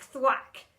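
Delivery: plain reading voice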